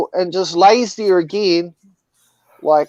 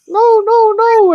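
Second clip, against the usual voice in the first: much higher and louder little no-no-no; mouse squeal; 7 dB louder, slower than usual. Retold or imitated. The mouse refuses the snake with a much higher, louder squeal. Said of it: "the mouse was like".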